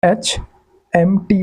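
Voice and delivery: HMT मसाले drawn out slowly; slow teacher demo; slow spelled-out letters. Shown as a demonstration. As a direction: stretch HMT like reading an ad slowly. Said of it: "जैसे हम बोलते हैं HMT".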